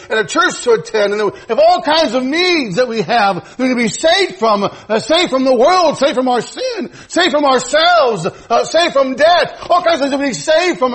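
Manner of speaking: full-throated pulpit exhortation; impassioned preaching. Acted. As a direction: drive the list up to a ringing climax. Preacher building to a climax.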